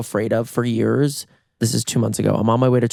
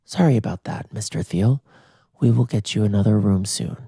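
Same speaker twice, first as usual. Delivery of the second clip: unbothered, lower-pitched clerk voice; imitated receptionist with no energy; 4 semitones lower than usual. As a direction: deliver it as an unmoved front-desk clerk. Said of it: "He is so low energy".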